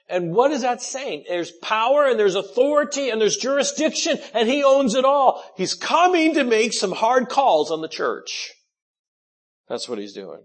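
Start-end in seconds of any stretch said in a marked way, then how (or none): 0.1-8.5 high, loud, ringing proclamation; preaching at full force. Acted. Preacher proclaiming to the church that he owns it all. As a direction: lift the congregation with his authority.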